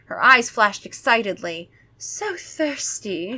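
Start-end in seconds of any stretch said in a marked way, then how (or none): 2.0-3.4 higher, very slow soft moan; thirsty vampire. Acted. Gwendolyn craving blood, slow soft moan. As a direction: draw out the hunger softly. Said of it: "she said".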